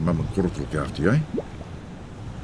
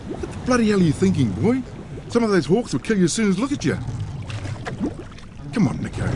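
angry scolding; 10 semitones higher than usual. Acted. Scolding the boy on the boat.

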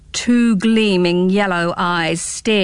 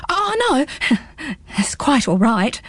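put-on higher, nervous boy backing off; a touch of nervous laughter, backing away; nervous gulp; 4 semitones higher, slower than usual. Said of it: "gulped Pins".

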